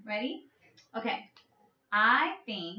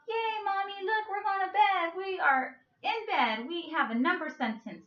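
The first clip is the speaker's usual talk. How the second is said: put-on higher, excited little kids cheering to mom; happy kids; 7 semitones higher than usual. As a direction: squeal it like proud kids in bed. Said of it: "They're happy".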